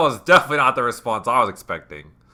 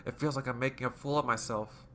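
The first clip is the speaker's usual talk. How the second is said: lightly voiced, slightly raised confession; Yuri; 9 dB quieter than usual. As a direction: confide it with understated distress. Voices Yuri's confession in the game.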